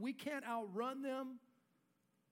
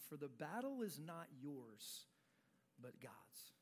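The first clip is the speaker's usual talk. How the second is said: a quiet, slow reading that sinks lower and softer at the end; 7 semitones lower, 10 dB quieter, slower than usual. Acted. Pastor reads the prophet's promise to the congregation, softly and sinking. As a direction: read it softly, fading almost to nothing on "but God's".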